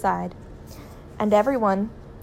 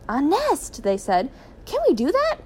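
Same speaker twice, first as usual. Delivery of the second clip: high, amazed questioning; astonished; 7 semitones higher than usual. A narrator's reading. Children hearing father's plan to build a nest, astonished. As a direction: voice leaps up in disbelief.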